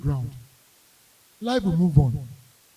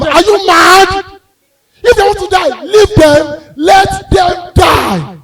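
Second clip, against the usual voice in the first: loud exasperated yell; angry scolding; 9 semitones higher, 10 dB louder, slower than usual. Retold or imitated. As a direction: bark at the annoying relative. Scolding the person stopping them.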